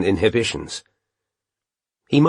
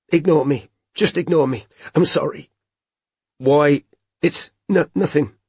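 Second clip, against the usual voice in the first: holding back tears; 5 semitones higher than usual. A narrator's reading. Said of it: "Robin was crying, though trying to conceal".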